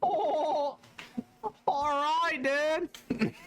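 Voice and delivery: very high, drawled surfer-dude voice; SpongeBob surfer voice. Pretend. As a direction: stretch it like a spacey cartoon surfer. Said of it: "I sound like the surfer from SpongeBob".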